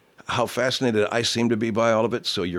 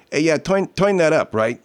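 put-on higher-pitched New York street accent; Brooklyn accent; 8 semitones higher, 3 dB louder than usual. Host showing how New Yorkers talk, Brooklyn accent. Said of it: "In Brooklyn or in New York".

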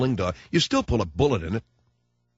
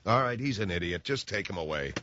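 dismissive mutter; 5 semitones lower, 7 dB quieter than usual. Retold or imitated. Acted officer dismisses.

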